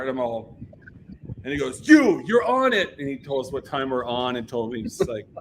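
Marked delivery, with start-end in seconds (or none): 1.9-3.0 high, loud, slow retold order; mock commanding shout; Kevin Lyman. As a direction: imitate singling someone out angrily.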